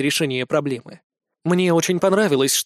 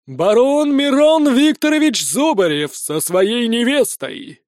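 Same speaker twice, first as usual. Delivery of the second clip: slow, high, loud herald's announcement; town crier; 6 semitones higher, 4 dB louder, slower than usual. A narrator's reading. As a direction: announce it grandly to the hall. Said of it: "разнёсся по залу голос глашатая".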